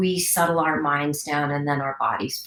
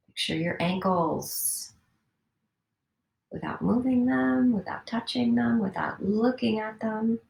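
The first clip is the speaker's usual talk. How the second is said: guided meditation; 3 semitones higher than usual. Acted. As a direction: coax them to stillness, toe by toe. Guides a body scan.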